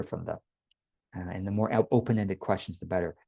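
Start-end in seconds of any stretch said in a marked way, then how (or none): none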